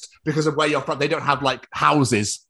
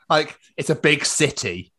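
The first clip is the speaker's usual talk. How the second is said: ordinary delivery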